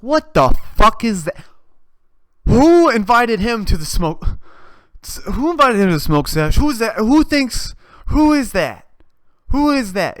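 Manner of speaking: loud, high-pitched indignant yelling; hammed-up outrage, punching each question; outraged demanding. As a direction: shout in appalled disbelief. Acted outrage at a naked guest.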